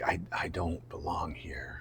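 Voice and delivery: slow, hushed and low; self-doubting whisper. Retold or imitated. Actor doubting himself on set, whispering. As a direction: whisper it as a creeping insecurity.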